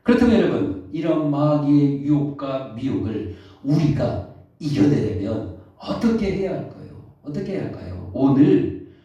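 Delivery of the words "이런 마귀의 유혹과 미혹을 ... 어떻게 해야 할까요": normal talking voice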